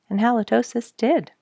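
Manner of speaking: plain reading voice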